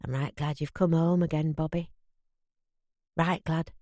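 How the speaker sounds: gruff man letting tenderness show; soft and tender. A narrator's reading. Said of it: "an unfamiliar softness in his voice".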